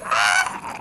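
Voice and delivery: very high squeak of panic; embarrassed squeaky yelp; squeaky cry. Retold or imitated. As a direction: squeal it, totally flustered. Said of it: "my voice was all squeaky".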